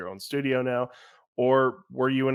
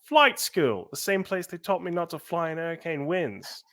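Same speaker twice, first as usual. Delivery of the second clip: higher-pitched, lightly voiced character line; Johnson quote; 7 semitones higher than usual. Answering a cue with a favorite game quote, lightly acted Johnson. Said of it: "do your favorite quote from Johnson".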